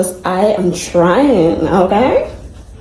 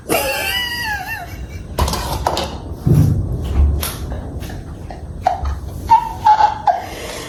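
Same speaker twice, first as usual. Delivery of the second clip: helpless laughter; 7 dB quieter than usual. Real, not acted. Helpless laughter at kitchen mess.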